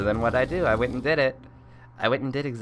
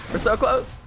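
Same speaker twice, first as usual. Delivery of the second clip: high, strained We're so close; high whine; 12 semitones higher, 3 dB louder than usual. Real, not acted. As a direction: squeak out We're so close. in tension.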